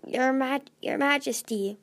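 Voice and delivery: ordinary delivery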